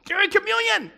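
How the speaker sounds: high-pitched disbelief at the outrage; incredulous repeat. Retold or imitated. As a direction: repeat it high, as if you still can't believe it.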